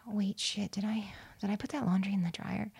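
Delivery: hushed, panicky whisper; whispered thought. Retold or imitated. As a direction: whisper it like a sudden distraction. Inner thought mid-meditation.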